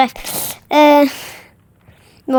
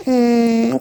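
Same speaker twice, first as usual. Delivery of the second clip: ordinary delivery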